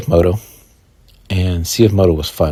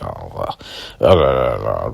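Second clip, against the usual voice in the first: snobby dismissal; 9 semitones lower than usual. Retold or imitated. Mocking the snobby riders.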